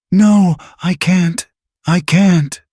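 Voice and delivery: high, strained panic; breaking down in panic; panicked, voice cracking. A narrator's reading. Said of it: "Maria's voice cracked".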